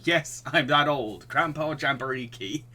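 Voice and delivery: ordinary delivery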